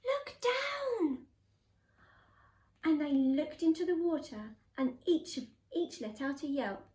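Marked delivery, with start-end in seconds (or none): 0.1-1.3 high, urgent storybook call; yelled warning. A narrator's reading. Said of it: "who yelled".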